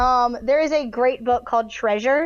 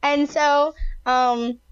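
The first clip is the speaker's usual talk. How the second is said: usual speaking voice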